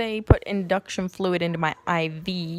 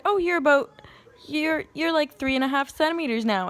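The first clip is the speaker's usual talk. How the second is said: higher, lightly voiced nurse; imitated nurse; 7 semitones higher than usual. Nurse telling a labouring woman how far along she is, lightly imitated.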